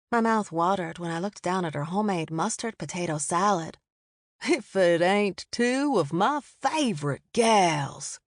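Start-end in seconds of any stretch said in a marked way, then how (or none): 4.4-8.2 slow, drawled friendly greeting; a charming good old boy sidling up; easygoing Southern man. A narrator's reading. Bobby Ray voiced by narrator.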